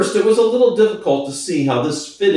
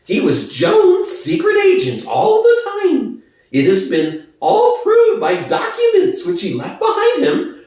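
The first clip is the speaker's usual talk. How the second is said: high, oily, wheedling pig voice; Squealer's high voice. Acted. Squealer to the animals, high and wheedling. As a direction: persuade the crowd, pitched way up and sly.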